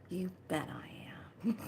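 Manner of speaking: whispered you bet I am. Retold or imitated. Replying to the shop lady in a playful whisper. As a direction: answer in a breathy secret.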